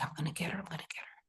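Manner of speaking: hushed, whispered self-urging; urgent whisper. Retold or imitated. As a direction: whisper it to yourself while running upstairs. Retold urgent muttering while running upstairs.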